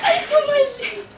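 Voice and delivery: high, loud laughing disgust. Real, not acted. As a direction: squeal it out, grossed out. Reacting to gross eating.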